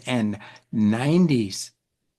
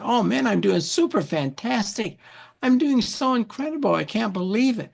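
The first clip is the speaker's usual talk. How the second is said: high, gushing delight; excited; 7 semitones higher than usual. Retold or imitated. Fred telling the church lady he feels fantastic, high gushing. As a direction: bubble over with how good you feel. Answering lady across the room.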